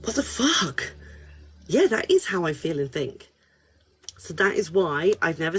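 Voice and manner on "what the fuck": stunned swear. Retold or imitated. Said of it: "And I was like".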